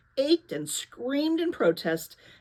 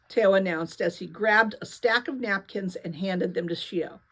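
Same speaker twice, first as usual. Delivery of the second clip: plain reading voice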